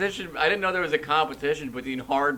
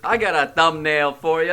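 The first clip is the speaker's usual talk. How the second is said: mock performer announcing; 4 semitones higher, 6 dB louder than usual. Replays the other speaker's thumbnail line.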